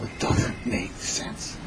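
slow, hushed thinking aloud; baffled whisper. Acted. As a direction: breathe it out slowly, to yourself. Son muses to himself.

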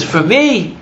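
high, loud startled question; incredulous question. Retold or imitated. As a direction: ask it in loud disbelief. Acted startled reply in retold dialogue.

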